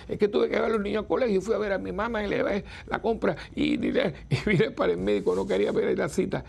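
high, fast rush of excuses; flustered lady's excuse. Retold or imitated. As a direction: rattle it off like a frazzled mother. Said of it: "pobrecita, dice".

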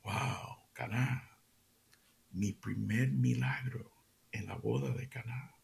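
wondering thought in a low whisper; inner thought whispered. Retold or imitated. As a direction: whisper it as if remembering. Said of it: "lo primero que piensa es".

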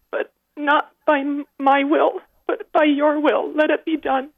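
pleading a prayer through tears; tearful. Real, not acted. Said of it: "Sorry, I just get so emotional".

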